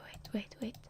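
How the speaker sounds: usual speaking voice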